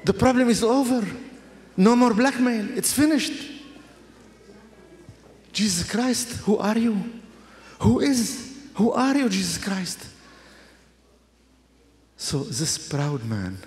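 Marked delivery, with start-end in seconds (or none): 0.0-10.0 awestruck wonder. Retold or imitated. Awestruck reliving of rescue.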